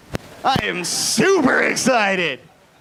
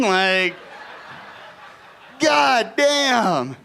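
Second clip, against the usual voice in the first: laughing; 4 semitones higher, much slower than usual. Real, not acted.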